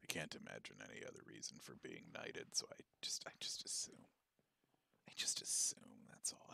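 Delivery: low, breathy aside; whisper. Real, not acted. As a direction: whisper it like a secret joke. Conspiratorial aside about knighthood.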